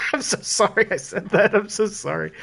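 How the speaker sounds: giggling through an embarrassed sorry; laughing apology. Real, not acted. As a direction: laugh it off, sheepish and amused. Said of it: "Edit that out".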